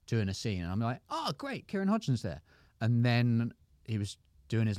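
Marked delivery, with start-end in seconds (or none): 1.1-2.4 high-pitched, pleased exclamation; gleeful high-voiced recognition; mock delight. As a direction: chirp it up high, delighted to spot a friend. Acting own delight watching the film.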